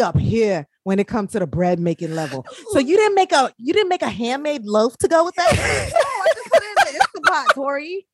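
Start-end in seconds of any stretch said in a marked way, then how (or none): 2.7-5.5 teasing friend about her handmade loaf; teasing shout. Real, not acted. 5.5-8.1 much higher, fast, laughing yell; laughing defensively at friend's teasing; laughing shout. Real, not acted.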